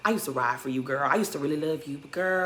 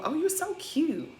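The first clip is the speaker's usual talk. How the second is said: higher, slow, sugary mock greeting; mocking sweet gush; 10 semitones higher, 8 dB quieter than usual. Mocking Robin's fake sweetness to Sierra, high and slow. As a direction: coo it like a fake-nice friend.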